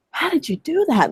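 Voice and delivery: high-pitched, incredulous question; retold amazement. The big guy asks the coach about her page, retold in high-pitched amazement. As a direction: imitate someone utterly baffled and impressed. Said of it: "he was just like".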